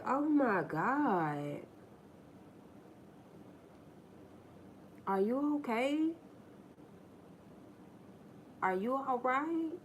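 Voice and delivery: high, slow fake concern; mock concerned mother. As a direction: coo over her with put-on worry. Mock concern for sulking daughter.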